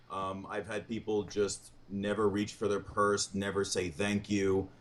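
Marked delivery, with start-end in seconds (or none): none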